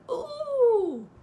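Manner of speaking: piglet squeal going up in the air; a loud, high little yelp. A narrator's reading. Piglet flung into the air, squealing loud and high. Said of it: "he said".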